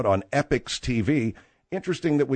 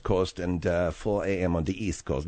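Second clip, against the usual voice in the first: put-on German accent; 9 semitones lower than usual. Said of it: "trying my German accent out".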